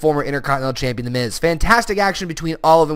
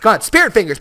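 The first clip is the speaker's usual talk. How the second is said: excited call; 6 semitones higher, 7 dB louder, faster than usual. Real, not acted. Cheering for the Spirit Squad.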